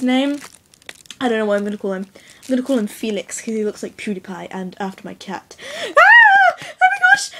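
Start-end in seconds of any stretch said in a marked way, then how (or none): 6.0-7.3 very high, loud squeal of joy; a very high squeal of delight at the Great Dane; excited shriek. Real, not acted.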